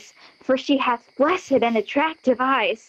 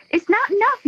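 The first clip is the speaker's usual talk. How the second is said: pitched up high in protest; rising indignation; 9 semitones higher, faster than usual. Acted. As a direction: push it higher the second time. Helena's indignant repetition.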